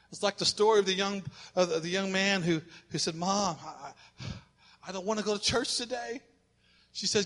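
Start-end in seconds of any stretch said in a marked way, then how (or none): 3.1-6.2 a soft, reluctant son's whine; whiny quiet son. Retold or imitated. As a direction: mumble it like a boy who won't get up. Son whining to mom.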